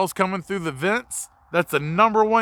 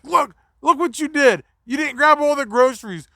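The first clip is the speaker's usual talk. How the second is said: scolding mom; 8 semitones higher, 4 dB louder than usual. Retold or imitated. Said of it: "she's like".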